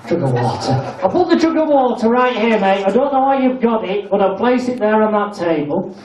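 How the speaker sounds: ordinary delivery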